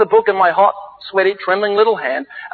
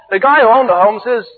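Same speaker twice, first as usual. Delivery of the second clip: ordinary delivery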